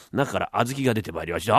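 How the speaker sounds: normal talking voice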